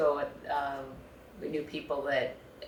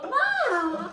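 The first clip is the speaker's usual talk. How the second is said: drawn-out high-pitched whine of "Mom"; mock mortified kid. Kids protesting to their mother, mock mortified. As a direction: wail it like an embarrassed child. Imitating embarrassed kids calling out.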